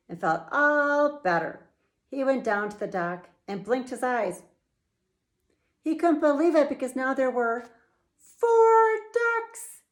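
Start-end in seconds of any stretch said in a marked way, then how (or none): 5.8-9.8 fast build to a very high, loud four ducks; amazed storyteller reveal; astonished. A narrator's reading. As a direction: burst out with the count in disbelief.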